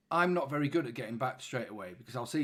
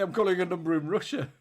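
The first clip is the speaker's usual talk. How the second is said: laughing; 6 semitones higher, 7 dB louder than usual. Real, not acted. Laughs at wrong number.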